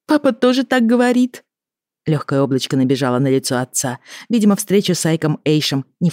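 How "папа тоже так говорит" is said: put-on high-pitched little girl; ringing girl's voice. A narrator's reading. Lili chiming in about her papa, high and girlish. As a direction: chime in like a girl, pitched well up.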